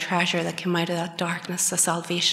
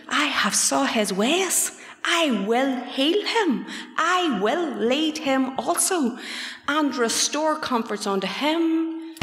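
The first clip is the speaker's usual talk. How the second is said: higher, slow, sung-out preaching; slow preacher's chant, pitched up; preaching God's words; 7 semitones higher, slower than usual. Retold or imitated. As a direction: proclaim the promise high and drawn out. Preaches God's promise aloud.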